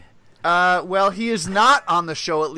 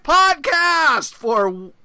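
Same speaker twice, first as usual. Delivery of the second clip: announcer shout; 9 semitones higher, 4 dB louder, much slower than usual. Acted. Announcer hyping show name.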